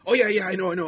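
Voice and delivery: loud, high mock apology; exaggerated flustered customer backtracking. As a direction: jump in high and loud to placate. Mimics customer hurriedly backtracking.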